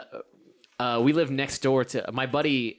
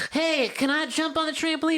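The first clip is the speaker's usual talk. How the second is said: put-on high small kid asking; little kid voice. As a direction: ask like an eager five-year-old. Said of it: "he'd be like".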